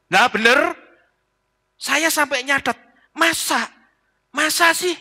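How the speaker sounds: high, hammed-up put-on voice of disbelief; mock surprise. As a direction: overplay the shock way up high. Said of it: "pura-pura kaget". (pendeta pura-pura kaget dengan nada tinggi)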